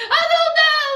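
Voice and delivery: high-pitched, hammed-up wail; mock crying. Crying fiancée answering her man, mock crying. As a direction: wail it in exaggerated tears. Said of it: "You crying".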